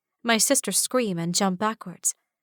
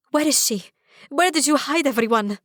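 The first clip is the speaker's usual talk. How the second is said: high, louder frantic calls; a mother frantic for her missing baby; panicked calling out; 6 semitones higher, 4 dB louder than usual. Acted. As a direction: search the empty palace in panic. Mother searching for her child.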